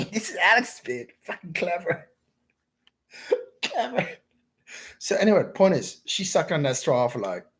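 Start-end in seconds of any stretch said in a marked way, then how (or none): 0.1-4.1 high laughing praise; giggly, high-pitched admiration. Real, not acted. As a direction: crack up while praising her. Laughing at Alex's clever order.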